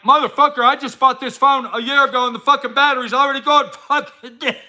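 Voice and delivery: high, fed-up mock rant about a dying phone; mock phone owner. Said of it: "they're act like".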